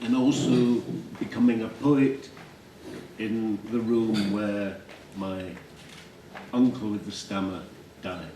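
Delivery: ordinary talk, nothing marked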